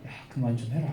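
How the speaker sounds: low, soft, fed-up grumble; weary plea. Retold or imitated. Paul to the girl who keeps pestering him, weary plea. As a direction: say it under your breath, low and worn out.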